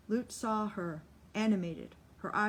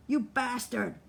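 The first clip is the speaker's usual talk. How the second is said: louder, raised angry cry; 4 semitones higher, 5 dB louder than usual. Acted. Solly yelling at China in anger. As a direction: snarl it at the one who threw the water. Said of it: "He cried and went for China".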